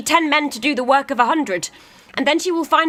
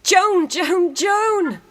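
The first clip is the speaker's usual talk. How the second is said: rising, higher calls across a crowd; calling out; 7 semitones higher, much slower than usual. Acted. Calling out to Joan.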